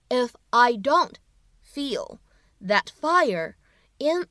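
slow, phrase by phrase with pauses; slow teaching read with long pauses; slow, split into chunks. Shown as a demonstration. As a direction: read it slowly in small chunks for learners. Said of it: "我们需要注意哪些发音技巧呢".

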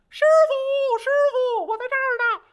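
high, loud monkey cry; monkey calling. A narrator's reading. Monkey calling his master from under the mountain, high and loud.

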